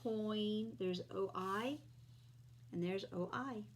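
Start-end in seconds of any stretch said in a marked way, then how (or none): none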